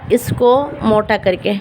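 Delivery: usual speaking voice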